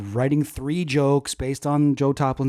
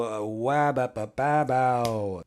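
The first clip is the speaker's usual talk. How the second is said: silly intro noise; 3 semitones lower, much slower than usual. Pretend. Mock late night intro.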